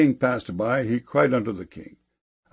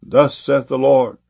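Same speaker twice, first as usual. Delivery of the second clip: louder, slow proclamation; raised narrated cry; cried proclamation; 3 semitones higher, 6 dB louder, slower than usual. A narrator's reading. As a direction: proclaim it slowly and firmly. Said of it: "He cried unto the man of God".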